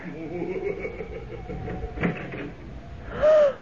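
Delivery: sharp high gasp of shock. Acted. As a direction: gasp as the door opens. Woman gasps in shock.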